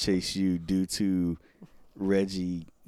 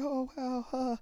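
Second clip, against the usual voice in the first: much higher, flustered reply; awkward girl voice; 6 dB quieter than usual. Retold or imitated. Girl stuck for words with a boy, much higher. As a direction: let it trail off awkwardly. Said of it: "She's like".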